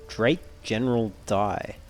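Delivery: ordinary talk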